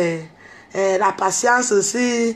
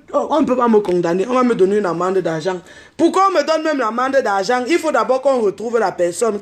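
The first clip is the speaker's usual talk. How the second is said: ordinary delivery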